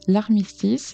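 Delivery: ordinary delivery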